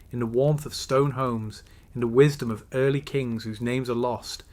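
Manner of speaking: ordinary delivery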